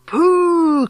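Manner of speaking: high, drawn-out "pooh"; disgusted cry. A narrator's reading. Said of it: "cried the audience".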